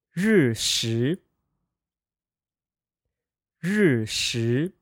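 日食 said rì shí, falling then rising; 日食 with fourth tone then second tone; practicing tones. Shown as a demonstration. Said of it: "fourth tone, second tone pair".

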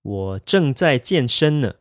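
slow and clear model sentence; slow practice repeat. Shown as a demonstration. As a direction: say it slowly for learners to copy. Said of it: "You would say".

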